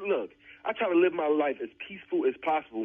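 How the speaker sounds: normal talking voice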